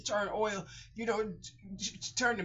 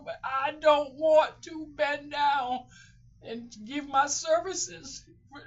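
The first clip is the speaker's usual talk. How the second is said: raised, pleading voice close to tears; real distress; 5 semitones higher, 6 dB louder than usual. Real, not acted. Speaker dreads having to sell their body for money, distressed. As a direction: insist on it, higher and louder, upset.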